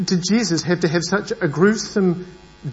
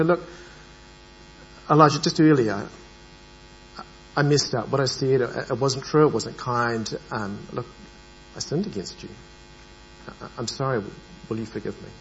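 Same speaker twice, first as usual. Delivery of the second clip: apologizing softly, growing quieter to the end; humble, fading, heartfelt sorry; sincere apology; 5 semitones lower, 5 dB quieter than usual. Acted. Speaker acting out an apology to Elijah, soft and sincere. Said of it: "and I say".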